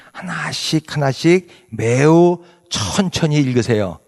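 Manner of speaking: drawn-out, word by word; slow and deliberate. Shown as a demonstration. Preacher urging unhurried Bible reading, slow and deliberate. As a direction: stretch each word out slowly.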